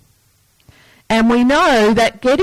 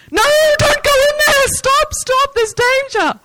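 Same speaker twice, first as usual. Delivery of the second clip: yelling at the screen. Retold or imitated. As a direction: warn the character like a fan in the cinema. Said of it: "the people who yell at the screen".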